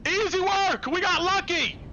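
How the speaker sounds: high, loud triumphant scream. Acted. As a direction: yell it out, high and gleeful. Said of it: "the Hooded Lantern guy screams".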